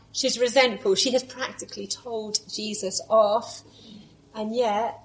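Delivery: normal talking voice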